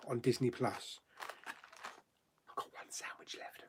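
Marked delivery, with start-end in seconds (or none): 2.5-3.6 hushed whispered aside. Real, not acted. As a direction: whisper it like a secret. Aside while eating on stream.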